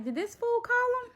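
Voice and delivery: high, disbelieving squeal of a question; shocked mock disbelief; incredulous. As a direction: ask it like you can't believe she did that. Retold disbelief at the neighbour.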